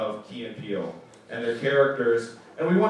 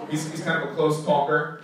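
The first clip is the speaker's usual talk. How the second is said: usual speaking voice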